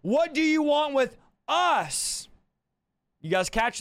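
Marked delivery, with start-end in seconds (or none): 0.0-2.3 high, drawn-out demon's cry; acted outcry, stretched and high-pitched. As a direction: cry out slowly as the possessed man. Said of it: "cried out saying".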